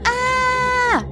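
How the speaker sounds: high, sustained scream; yell. A narrator's reading. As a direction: let out a startled high yell. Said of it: "yelled Raffy".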